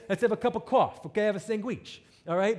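loud, high New Jersey accent. Shown as a demonstration. Preacher defending his speech, exaggerated New Jersey accent. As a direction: lean proudly into a broad New Jersey accent. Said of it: "So I say".

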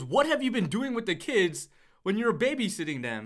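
put-on father questions the babysitter, loud and high; mock accusing dad. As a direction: grill the babysitter like a suspicious father. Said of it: "and he goes".

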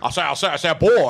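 a hint of cartoon rooster, repeating I say; Foghorn Leghorn. Host imitating Johnny's Foghorn Leghorn bit, lightly. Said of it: "you do like Foghorn Leghorn".

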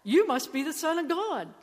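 a touch of stunned wonder; amazed exclamation. Retold or imitated. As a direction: blurt it out in awe. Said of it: "he exclaims".

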